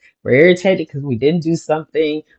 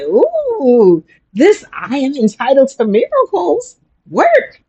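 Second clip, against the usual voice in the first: much higher, amazed ooh; delighted; 10 semitones higher than usual. Retold or imitated. She realizes the mantra worked, delighted and higher. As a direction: marvel that the lesson worked, higher. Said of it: "I'm like".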